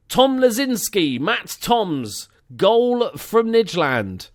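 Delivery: loud, high announcer name-read; announcer roll call. Pretend. Host announcing new Patreon supporters, loud announcer roll call. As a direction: proclaim each name like an announcer.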